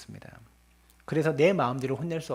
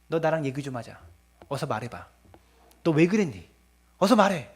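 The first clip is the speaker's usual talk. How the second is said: angry parent; 2 semitones higher, 4 dB louder than usual. Retold or imitated. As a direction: press the child, then blow up at them. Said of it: "그러면서 막 이렇게 화를 내서는".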